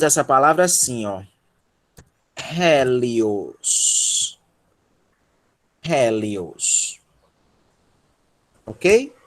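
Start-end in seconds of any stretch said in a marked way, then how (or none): 2.4-7.0 "Hélios" said slowly with an audible English-style H; careful, stretched "Hélios" with a strong initial H; aspirated H. Shown as a demonstration. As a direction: model "Hélios" lower and drawn out, breath on the H. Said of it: "da aspiração forte como H".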